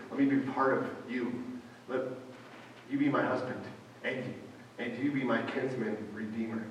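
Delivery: quiet, gentle asking; Ruth's hushed proposal to Boaz; soft plea. Retold or imitated. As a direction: ask him softly and humbly.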